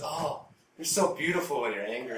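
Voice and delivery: a hint of gushing, played for laughs; imitated smitten flattery. Houseguest flattering the angry mom, mock smitten. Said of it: "He goes like this".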